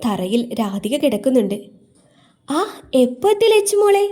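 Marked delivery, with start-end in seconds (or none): 2.5-4.0 high, louder, delighted greeting; smiling welcome. A narrator's reading. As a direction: greet a dear girl with a smile in the voice. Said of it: "പുഞ്ചിരിയോടെ ചോദിച്ചു". (പുഞ്ചിരിയോടെ, സ്നേഹത്തോടെ)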